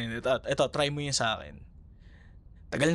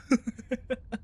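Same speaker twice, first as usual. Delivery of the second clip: light laugh; 9 semitones higher than usual. Acted. Laughs after joke about sleep.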